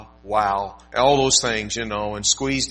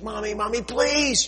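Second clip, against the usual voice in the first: put-on much higher child's whine; little girl begging; 11 semitones higher than usual. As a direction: plead like a small girl. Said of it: "She goes".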